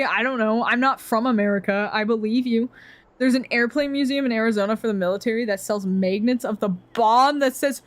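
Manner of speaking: ordinary talk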